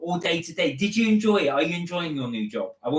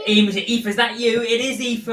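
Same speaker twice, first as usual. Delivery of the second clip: high, loud, excited recognition; excited greeting; 9 semitones higher, 6 dB louder than usual. Real, not acted. Host spotting Aoife in the chat, excited greeting. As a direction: greet a friend in a high, raised voice.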